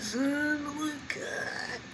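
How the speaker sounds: high, drawn-out, stretching the words; savoring excitement. Real, not acted. Admiring the chicken to viewers, high drawn-out delight. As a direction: savor it, voice jumping up high and slow.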